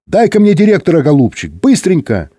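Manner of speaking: high, insistent request falling away; hurried high-pitched call. A narrator's reading. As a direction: badger the operator eagerly. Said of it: "закричал кто-то в динамике".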